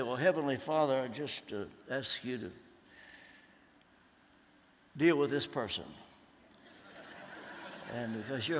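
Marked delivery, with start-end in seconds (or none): 0.0-5.8 mock half-hearted prayer. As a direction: mumble a grudging prayer. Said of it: "he doesn't mean that you just say".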